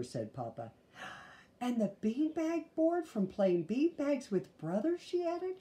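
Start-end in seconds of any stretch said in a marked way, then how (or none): 1.6-5.6 put-on little girl voice. Sister adds eagerly.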